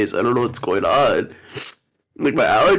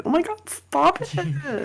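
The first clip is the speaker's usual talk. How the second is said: high, slow, softer mock plea; imagined listener's reaction; 5 dB quieter, slower than usual. Imagining podcast listeners hearing this, mock pleading. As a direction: imitate a listener begging for it to stop. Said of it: "people listening to me going".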